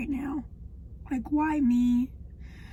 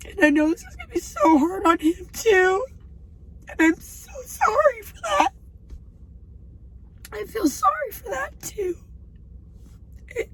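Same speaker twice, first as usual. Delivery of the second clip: high, tear-choked voice; real crying; 8 semitones higher than usual. Real, not acted. Wife sorry for husband's burden, crying as she speaks. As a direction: voice climbing as tears take over.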